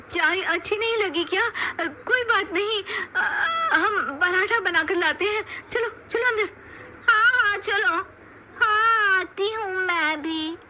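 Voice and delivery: high, lightly tearful eagerness; eager bahus. Acted. Daughters-in-law eagerly offering parathas to guest, high.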